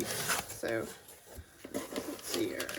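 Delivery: hushed aside to herself; whispering. Real, not acted. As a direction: whisper it under your breath. Muttering to herself while checking box.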